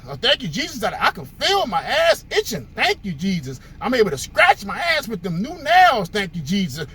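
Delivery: mock a woman overflowing with praise, thanking Jesus for everything; a lively, overdone church lady sending every little thing up to Jesus; gushing church lady. Brother's story, church lady's answer.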